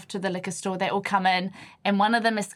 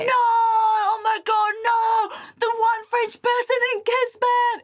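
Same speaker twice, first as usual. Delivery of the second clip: high, drawn-out mock howl; mimicked group wail; 14 semitones higher, 4 dB louder, slower than usual. Friends reacting to their mate's blunder, mock anguished shouting. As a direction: wail loudly like mates in hysterics. Retelling friends' loud horrified howling.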